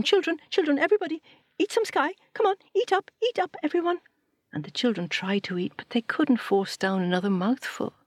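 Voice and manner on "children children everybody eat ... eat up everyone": much higher, urging everyone to eat up; high coaxing call. Acted. Satu calling her children to eat up, high and coaxing. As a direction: coax the children like a fretting mother.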